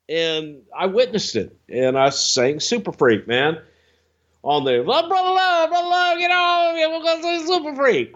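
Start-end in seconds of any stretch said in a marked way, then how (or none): 4.8-8.1 very high, slurred, garbled rock star voice. Retold or imitated. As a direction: mumble it high and unintelligible. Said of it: "I couldn't understand a word".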